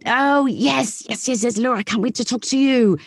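high, gushing mock enthusiasm; imitating Rachel. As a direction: play up her eager excitement. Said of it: "I love imitating Rachel".

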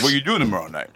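put-on tough-guy voice asking for a date; mock macho impression, played for laughs; Sly. Teller voices her asking Joe out, hammed-up Sly impression. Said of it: "Do it in the Sly voice".

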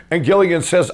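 ordinary delivery

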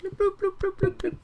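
tiny high-pitched bloops; playful bloop noises. Pretend. Salamander holding hands with Edric, in high playful bloops. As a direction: repeat very high, silly little bloops.

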